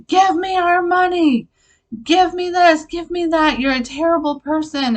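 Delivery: high, loud mock scream of demands; retold screaming. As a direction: imitate a screaming, menacing stepdad.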